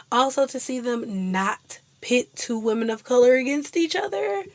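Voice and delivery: usual speaking voice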